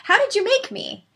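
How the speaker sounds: high-pitched imitation of a curious little girl; six-year-old daughter. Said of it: "she looks at me and she goes".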